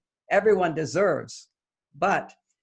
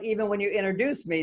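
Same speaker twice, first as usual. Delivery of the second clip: normal talking voice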